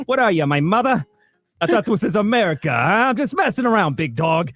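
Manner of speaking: high, hammed-up East Coast tough guy; brash East Coast accent. Acting his brash East Coast character.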